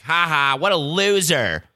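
high sneering ha ha, slow loser; mocking taunt. Shown as a demonstration. Mocking a podcast fan in front of friends, high slow sneer. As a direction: jeer like a schoolyard bully. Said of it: "I'm not gonna do it like that".

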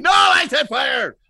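high, loud, slow mock yell; mock angry shout. Joking back at the host, a hammed-up angry shout. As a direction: ham up the angry correction. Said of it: "so mad when he yelled it".

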